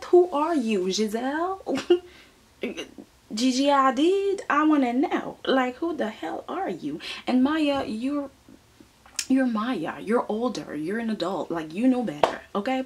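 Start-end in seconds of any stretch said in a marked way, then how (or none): 0.0-7.0 incredulous. Real, not acted. Outraged at model's attitude.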